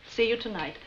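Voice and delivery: ordinary delivery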